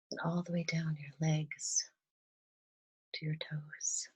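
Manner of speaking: soft, calm meditation voice with long pauses; guided meditation. Real, not acted. Guide leading listeners through breathing and gratitude, softly meditative. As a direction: gently guide them into relaxation, leaving space between phrases.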